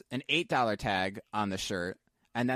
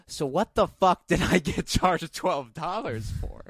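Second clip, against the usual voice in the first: slow, higher-pitched exasperated demand; retold indignation; 4 semitones higher, 4 dB louder than usual. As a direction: spell out the outrage word by word. Retold quarrel at the counter.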